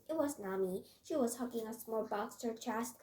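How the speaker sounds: plain reading voice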